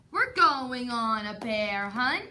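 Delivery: ordinary delivery, nothing marked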